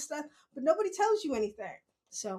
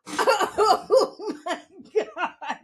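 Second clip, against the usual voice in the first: very slow, laughing and higher; laughing oh my god; 6 semitones higher, 7 dB louder, much slower than usual. Retold or imitated. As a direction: stretch it out between laughs. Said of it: "I was like".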